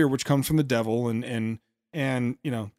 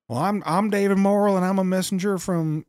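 mock Southern accent; 6 semitones higher than usual. Commentator mimics a Southern delegate introducing himself at the microphone, lightly put-on Southern accent. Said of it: "that's how it sounded".

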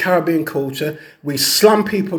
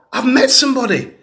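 I've met somebody, pitched much higher, a thrilled announcement; excited reveal; 8 semitones higher, faster than usual. Retold or imitated. Client tells counsellor Delroy her big news, high and excited.

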